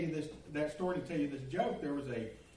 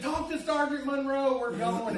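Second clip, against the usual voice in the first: loud, high, hollered reply; shouted retort; 10 semitones higher, 9 dB louder than usual. Retold or imitated. Prankster driver answering the sergeant in back, loud high shout. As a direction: holler back as you take off.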